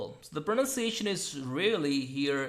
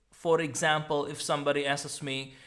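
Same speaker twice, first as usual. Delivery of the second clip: usual speaking voice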